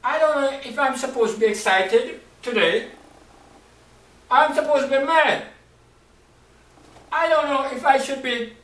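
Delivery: high, loud, anxious outburst; a man who can't decide whether to cheer or rage; torn and agitated. Acted. Joseph torn between joy and anger, crying out high and loud. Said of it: "So Joseph is like".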